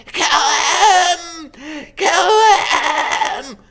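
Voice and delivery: high, loud screeching yell; Voldemort yelling. A narrator's reading. Voldemort yells at Quirrell to kill Harry, loud shrieking yell. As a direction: shriek the order with murderous fury. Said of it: "Voldemort's yells".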